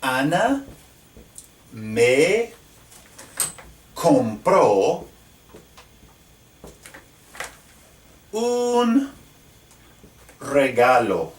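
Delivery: each word spaced out with long pauses; word-by-word dictation, drawn out; slow dictation word by word. Shown as a demonstration. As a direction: spell it out slowly as you write. Dictating while writing on board.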